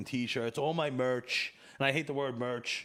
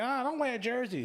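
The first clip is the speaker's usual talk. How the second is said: mocking critics; 10 semitones higher than usual. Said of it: "people are like".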